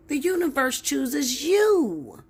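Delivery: high and loud, a bit slow; heated. Real, not acted. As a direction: hammer it home. Heated rant.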